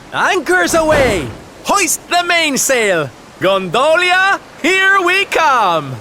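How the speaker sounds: high, loud, drawn-out sailing orders; triumphant calling out. Acted. Captain setting sail for Gondolia, triumphant calling out. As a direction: belt it out like a rousing captain.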